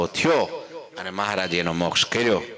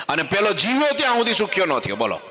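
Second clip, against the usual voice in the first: impassioned preaching; 9 semitones higher, faster than usual. Acted. Preacher warning the gathering, high and impassioned. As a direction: drive home the misery of ego.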